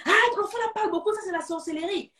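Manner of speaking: gossiping accusation. Acted. Relatives accusing talkative child.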